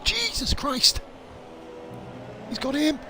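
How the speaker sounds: high, thrilled yelling; excited shouting. Real, not acted. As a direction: shout it as the cars collide. Race overtake, shouting.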